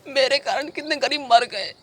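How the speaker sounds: high, anguished begging; desperate pleading. Acted. Guilty man begging sahab to end his life, desperate. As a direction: plead with a wail, pitch climbing and staying up. Begs sahab to have him killed.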